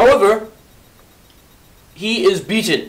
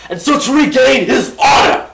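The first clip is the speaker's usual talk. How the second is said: dramatic shout; 10 semitones higher, 7 dB louder than usual. Real, not acted. Sudden dramatic outburst in telling.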